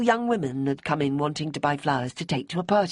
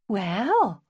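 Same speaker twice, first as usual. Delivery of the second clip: hesitant; 5 semitones higher than usual. A narrator's reading. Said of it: "Bonnie began with uncharacteristic hesitation".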